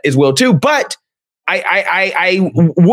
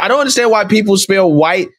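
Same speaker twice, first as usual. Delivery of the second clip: ordinary delivery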